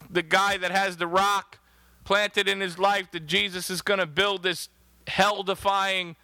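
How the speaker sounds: normal talking voice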